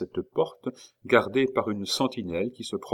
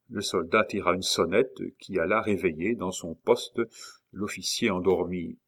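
usual speaking voice

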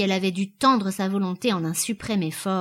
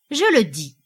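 firm, louder statement; affirming; 3 semitones higher, 6 dB louder than usual. A narrator's reading. Sailor confirming he will buy her, firmly. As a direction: assert it with certainty. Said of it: "affirma le nouveau venu".

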